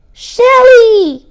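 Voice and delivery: high, very loud panicked cry; panicked gasp. Acted. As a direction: cry out the forgotten crab's name in panic. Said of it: "gasped Sam".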